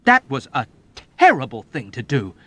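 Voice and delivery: loud reproachful shout; young officer shouting reproach at the old man. A narrator's reading. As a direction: scold him hard. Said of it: "Nately shouted at him reproachfully".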